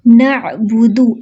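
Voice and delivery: very slow melodic tajweed recitation; Quran recitation. Shown as a demonstration. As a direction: draw it out as tilawat. Continues recitation. (धीमी तिलावत)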